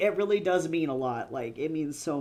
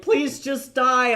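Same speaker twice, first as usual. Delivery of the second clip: mock exasperated plea; 9 semitones higher, 10 dB louder, much slower than usual. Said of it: "Darius is like".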